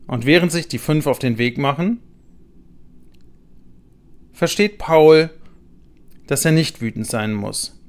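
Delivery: plain reading voice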